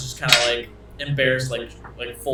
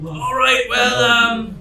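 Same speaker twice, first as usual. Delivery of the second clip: addressing a crowd; 7 semitones higher than usual. Acted. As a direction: speak up to a whole crowd. Captain addresses the whole crowd.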